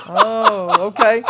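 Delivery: very high, loud mimicked "oh"; hammed-up dawning understanding of a caller; mock realization. Caller reacts to heated pool answer.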